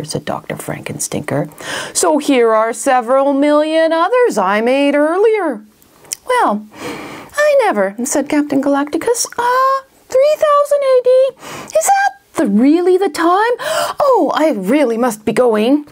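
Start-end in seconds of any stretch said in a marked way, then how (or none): 1.9-5.6 high, gleeful villain reveal; gloating villain. Acted. Villain gleefully unveils spider army. 6.3-7.9 high-pitched, hammed-up shock; flustered astonishment. Captain flustered by spider army. 9.4-15.9 very high, jittery excuse-making; hammed-up nervous wish to escape; flustered panic. Captain making panicky excuse to leave.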